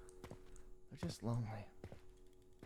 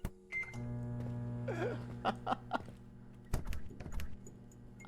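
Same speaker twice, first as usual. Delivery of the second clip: high squealing laugh; 4 dB quieter than usual. Real, not acted. Big laugh after scare.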